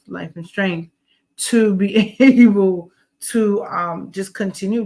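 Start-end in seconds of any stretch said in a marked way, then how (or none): 1.4-3.6 laughing through the words; breaking into a laugh. Real, not acted. Laughs mid-sentence.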